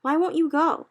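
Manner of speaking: high, loud demand; urgent plea. A narrator's reading. Sophie demanding an answer from the BFG. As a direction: push him for an answer.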